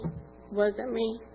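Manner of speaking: "wasn't" dropped soft and drawn out; sincere, soft and slow humble echo; quiet, slow repeat. Real, not acted. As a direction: repeat it softly and slowly, letting it sink in. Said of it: "And it wasn't me".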